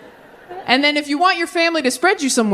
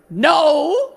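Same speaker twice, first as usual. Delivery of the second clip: mock protest; 6 semitones higher than usual. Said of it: "all the men were like".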